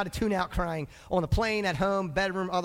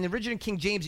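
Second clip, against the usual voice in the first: normal talking voice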